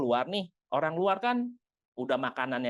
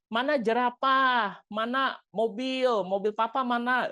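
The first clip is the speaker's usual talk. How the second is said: put-on higher, louder, slow asking to a toddler; parent quizzing toddler; 7 semitones higher, 3 dB louder, slower than usual. Parent asking toddler to point at toys, slow and higher. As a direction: coax the child to point.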